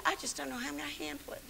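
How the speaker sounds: quick, hushed whisper; overwhelmed mother whispering her worry. Retold or imitated. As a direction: rush it out under the breath.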